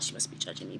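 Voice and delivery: voiceless whisper of panic; whispering to herself in embarrassment; whispered worry. Retold or imitated. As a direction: let the fear drop to a whisper. Anxious inner thought, trailing off.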